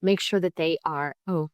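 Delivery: normal talking voice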